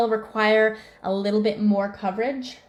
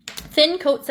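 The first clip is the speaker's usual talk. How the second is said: "Thin" raised and louder; stress on thin; 6 semitones higher, 4 dB louder than usual. Real, not acted. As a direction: hit the word "Thin" high. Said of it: "very thin coats".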